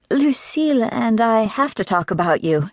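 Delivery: light, teasing lift on each word; cheeky secretary teasing her boss. A narrator's reading. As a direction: try to sound cheeky and breezy. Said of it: "trying for the cheeky tone".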